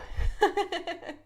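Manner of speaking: high, chuckling release; real high-pitched giggle; laugh. Real, not acted. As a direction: laugh it off after getting carried away. Laughs after own outpouring.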